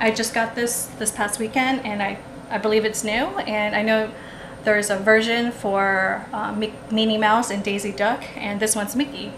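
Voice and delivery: normal talking voice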